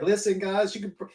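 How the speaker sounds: high, hammed-up sales pitch; mock pitchman voice. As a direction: mimic a cheesy autograph pitch. Mocking the guy's sales pitch.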